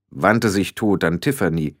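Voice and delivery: ordinary delivery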